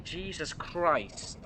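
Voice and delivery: usual speaking voice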